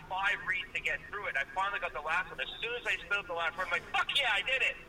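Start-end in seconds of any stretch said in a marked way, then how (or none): 3.9-4.8 mock-triumphant, higher-pitched cheer; retold triumph. Retelling how he got through the script, triumphant. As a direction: celebrate finally getting through it.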